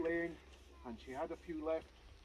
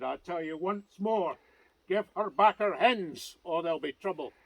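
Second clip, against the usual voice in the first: stern warning; 8 dB louder than usual. Acted. Macomie's final warning.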